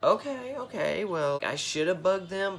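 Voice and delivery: surprised. Retold or imitated. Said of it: "and I'm like".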